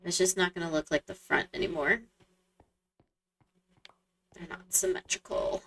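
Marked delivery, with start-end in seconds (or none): 4.3-5.6 whispering. Real, not acted. Muttered aside to self.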